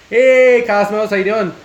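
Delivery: loud, high cheerful hello; excited greeting. Real, not acted. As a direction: call out a happy hey.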